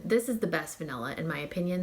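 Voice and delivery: usual speaking voice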